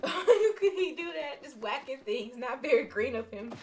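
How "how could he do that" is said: louder, higher laughing question; laughing in disbelief. Real, not acted. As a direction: laugh through it.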